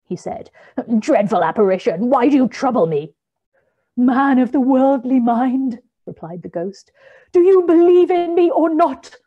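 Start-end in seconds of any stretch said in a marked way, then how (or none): 1.0-3.1 loud, fast frightened plea; fearful plea. A narrator's reading. Scrooge kneeling begs ghost. 3.9-9.2 high, loud wailing spirit; Marley's ghost demanding belief from Scrooge; ghostly voice. A narrator's reading. The ghost speaks.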